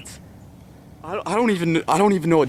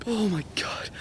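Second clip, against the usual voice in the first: whisper; 4 semitones higher, 8 dB quieter than usual. Real, not acted. Stunned hunter right after the kill.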